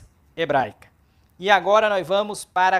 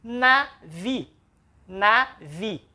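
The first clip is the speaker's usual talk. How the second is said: NAVI broken into "na", pause, "vi"; split into syllables; much slower than usual. Shown as a demonstration. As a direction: say navi slowly as two separate syllables, na - vi. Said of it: "separou aqui".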